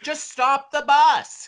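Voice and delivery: high, loud, drawn-out mock plea; exasperated yell. Moviegoer yelling at the film's characters, exasperated. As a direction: plead loudly with the screen, stretching the words. Voices exasperated theater audience shouting at screen.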